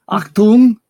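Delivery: hammed-up German order; barked German. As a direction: play the commanding German. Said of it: "well, speak German".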